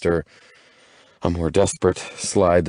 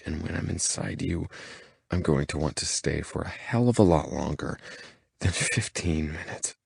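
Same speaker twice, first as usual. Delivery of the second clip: low, softer, intimate promise; harsh low voice; 6 dB quieter than usual. Acted. Man promising his girlfriend a long night, low and soft. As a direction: hold it low and close to her ear. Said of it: "My voice goes harsh, low".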